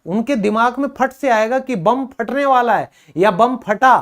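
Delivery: normal talking voice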